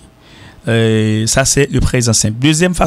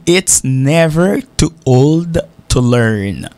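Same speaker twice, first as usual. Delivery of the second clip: slow model reading; much slower than usual. Shown as a demonstration. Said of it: "teacher models the English proverb".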